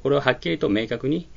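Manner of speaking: ordinary talk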